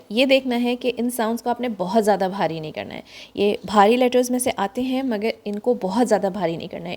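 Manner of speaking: ordinary talk, nothing marked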